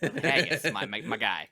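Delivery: laughing through the words. Real, not acted. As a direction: break into laughter at the joke.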